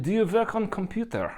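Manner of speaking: high-pitched imitated Slavic accent; her Polish accent. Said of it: "in her accent".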